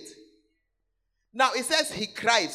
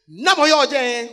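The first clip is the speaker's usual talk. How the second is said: loud booming call of a name from the back door; a military man calling out as he walks in; retold shout; 2 semitones higher, 10 dB louder than usual. Father-in-law announcing himself at the door, loud call. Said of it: "he'll start by shouting".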